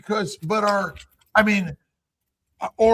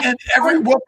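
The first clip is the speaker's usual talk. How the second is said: laughing; 2 semitones higher, 7 dB louder, much faster than usual. Real, not acted. Still laughing.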